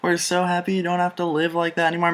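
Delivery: high-pitched imitation of overjoyed, supportive parents; gushing relief. Parents react to child coming out, high gushing sympathy. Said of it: "all the anger in the room just evaporated".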